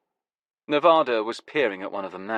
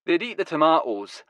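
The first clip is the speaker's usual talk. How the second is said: put-on Glasgow accent; 6 semitones higher than usual. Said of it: "his Glasgow accent now going full throttle".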